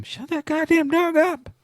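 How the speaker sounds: high, drawn-out put-on voice; mock-angry squawk at a noisy dog; mock yelling. Mock yelling about barking dog.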